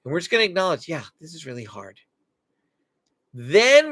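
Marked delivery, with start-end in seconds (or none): 0.9-2.0 much softer, calm and low-key; calm acknowledgement. Shown as a demonstration. Parent staying calm with a yelling child, soft. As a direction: stay calm so they can borrow it. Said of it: "we're gonna stay calm".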